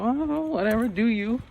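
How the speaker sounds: high, soft mock shrug; teacher shrugs off the kid's mood, high and airy; indifferent whatever. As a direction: toss it off like you don't care. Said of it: "I'm just kinda".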